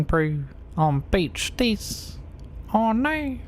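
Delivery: put-on high, soft New Zealand bro accent; lightly mocking Kiwi slang voice. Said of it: "She does great voices".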